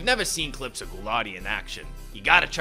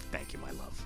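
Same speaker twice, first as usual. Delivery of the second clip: lower, much softer thanks; tender; 10 semitones lower, 10 dB quieter than usual. Real, not acted. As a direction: whisper a loving thanks. Thanks wife softly.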